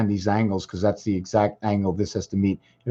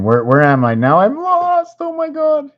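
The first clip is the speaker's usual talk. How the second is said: flustered wail climbing far above usual pitch; flustered outcry; 5 dB louder than usual. Real, not acted. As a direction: voice shooting up into a very high squeal. Lost while navigating the screen, cries out.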